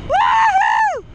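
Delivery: high joyful whoop; excited woohoo. Real, not acted. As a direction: whoop with thrill at speed. Thrilled at top speed.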